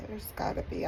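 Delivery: barely voiced, choked with real tears; whispered through tears; tearful whisper. Real, not acted. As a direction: voice breaking into a whisper. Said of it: "blubbering idiot".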